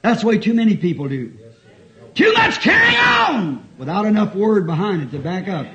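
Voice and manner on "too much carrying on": high, ringing pulpit cry; preaching shout. Real, not acted. Preacher warning people about empty emotion, shouting. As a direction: shout it out to the congregation. Preacher cries out his point.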